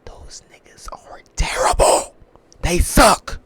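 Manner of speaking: exasperated, drawn-out condemnation; strained disgust. Real, not acted. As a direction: drag it out, then burst out high and loud. Emphatic verdict on the receivers.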